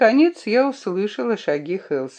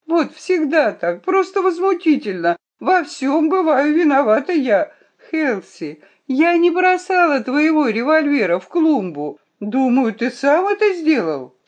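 high-pitched indignant protest; angry, tearful outburst; 9 semitones higher, 4 dB louder than usual. A narrator's reading. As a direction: protest as the wronged girl, upset. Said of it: "Гертруда вдруг разозлилась и заплакала".

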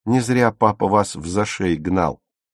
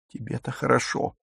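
softer but still resentful; slightly quieter; 3 semitones higher, 6 dB quieter than usual. A narrator's reading. Dagmar hushed by Silke, complaining only a little quieter. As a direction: pull the volume down, keep the grievance. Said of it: "лишь чуть-чуть тише сказала Дагмар".

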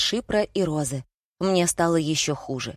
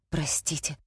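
usual speaking voice

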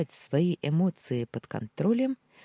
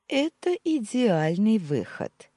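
slow, pitched-up, pleased; delighted; 7 semitones higher, 4 dB louder, slower than usual. A narrator's reading. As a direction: savour the perfect plan. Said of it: "обрадовалась девушка". (обрадованно)

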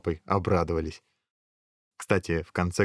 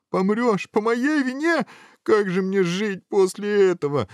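distraught wailing; 13 semitones higher, 3 dB louder than usual. Acted. Prince horrified the ogre will die through him, wailing. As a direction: wail in theatrical horror and guilt.

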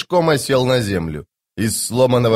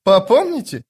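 high mocking echo of his word; scornful snort; 9 semitones higher than usual. A narrator's reading. Corporal scoffing at the sapper, mocking snort. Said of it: "фыркнула Хватка".

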